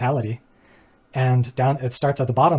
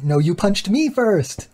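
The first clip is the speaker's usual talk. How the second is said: put-on high, slow childish comeback 'No, you punched me first' leaning on 'me'; whiny child retort; 7 semitones higher, slower than usual. The other kid whines back that it was him, high and slow. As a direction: throw the blame right back like a sulky kid.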